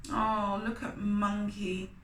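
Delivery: plain reading voice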